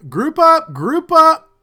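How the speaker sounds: high, loud rallying call; rally the men together; guard ordering his men to gather. Acted. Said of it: "calling out".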